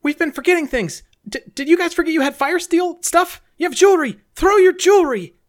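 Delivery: high-pitched urgent character voice; insistent, high character voice; urgent game character. Acted. Player speaks urgently in character.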